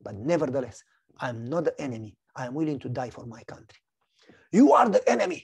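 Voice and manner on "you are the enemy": accusing officer. Retold or imitated.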